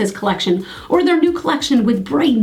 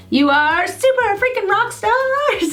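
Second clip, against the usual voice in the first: excited; 10 semitones higher, slower than usual. Real, not acted. Cheering on viewers at sign-off.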